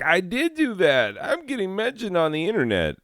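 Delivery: high, giddy student imitation; proud student. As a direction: play the thrilled kid getting famous. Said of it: "be like".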